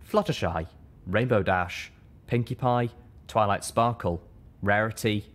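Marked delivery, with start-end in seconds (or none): none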